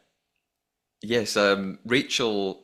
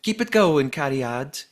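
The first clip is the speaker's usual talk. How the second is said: higher, louder Welsh lilt, Cariad rising at the end; Welsh accent; 4 semitones higher, 3 dB louder than usual. Retold or imitated. Podcaster redoing Fiona's message in a hammed-up Welsh accent. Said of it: "That's my Welsh accent".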